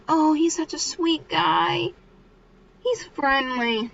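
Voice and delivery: high, drawn-out mock gushing; swooning girls. Girls praising the boy they like, put-on dreamy gushing. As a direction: imitate smitten girls, slowly and high. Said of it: "the girls are like".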